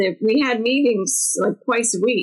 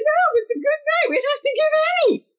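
high and fast, pleased with the night; cheerful report; faster than usual. Retold or imitated. Host to co-host after a calm night, high and bright. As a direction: chirp it out brightly, much higher. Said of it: "And I said".

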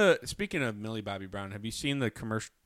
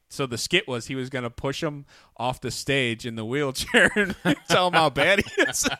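laughing; 4 semitones higher, 6 dB louder than usual. Real, not acted. Laughing while retelling the skit.

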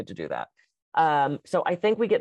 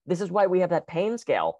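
normal talking voice

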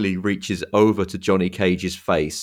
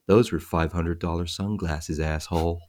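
low, softer muttered threat; muttered line; 3 semitones lower, 6 dB quieter than usual. Retold or imitated. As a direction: mutter it coolly under your breath. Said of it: "mutters the immortal line".

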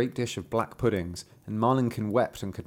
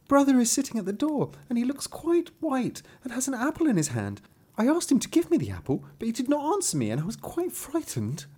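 put-on high childlike voice, a touch of fright; a small girl anxiously reporting her brother; little girl's voice; 13 semitones higher than usual. Marlinkin tells her mother about brother, high child voice.

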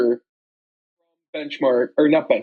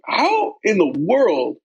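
mock disbelief; 6 semitones higher than usual. Podcaster recalling a huge sandwich challenge, retold disbelief. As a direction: stretch it out in retold amazement.

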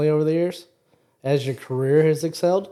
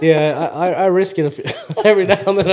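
laughing through the words; 2 semitones higher, 6 dB louder than usual. Real, not acted. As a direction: talk while laughing. Talking while laughing.

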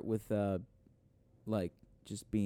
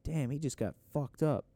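usual speaking voice

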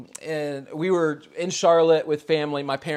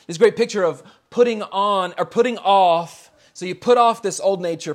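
louder, emphatic sermon delivery, stretching key words; preaching; 5 dB louder than usual. Acted. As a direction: drive home the call to put off sin. Preaching the passage.

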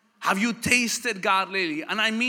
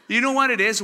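ordinary delivery

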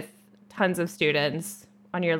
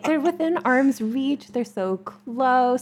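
slow teasing temptation; 6 semitones higher, 5 dB louder, slower than usual. Pretend. Teasing about the weed.

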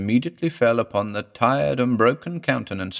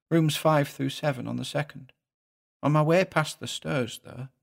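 soft, higher-pitched little-man voice; David, reluctant little husband; 4 semitones higher, 3 dB quieter than usual. Acted. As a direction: recount it meekly with a regional accent.

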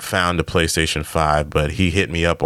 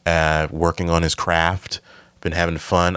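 normal talking voice

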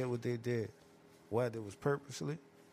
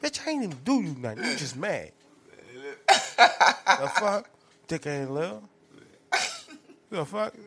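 loud, high indignant yelling; mock-outraged shouting; 6 semitones higher, 9 dB louder than usual. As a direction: yell back, offended and baffled. Outraged at insult.

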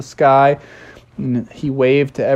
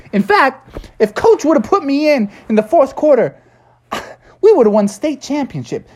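high, boastful glory-days voice; washed-up football hero; 11 semitones higher than usual. Acted. Mando bragging about his football days, high boastful voice. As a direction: brag about the old days.

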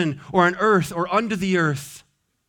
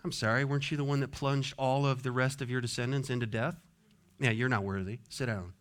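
quick, low, much softer put-down; dismissive aside; 6 semitones lower, 11 dB quieter, faster than usual. Acted. Someone answering Adam's claim to be worthy, dismissive aside. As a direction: drop to an offhand, quiet rebuke.

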